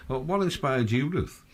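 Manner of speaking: ordinary delivery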